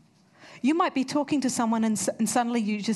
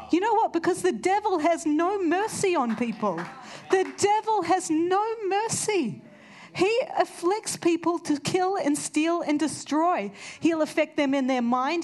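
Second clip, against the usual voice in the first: fervent preaching; 7 semitones higher than usual. Acted. Preacher rousing the congregation against the devil, fervent.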